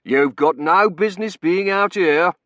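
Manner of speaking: loud, slow official scolding; stern warden. A narrator's reading. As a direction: tell off a trespasser sternly. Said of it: "the warden said sternly".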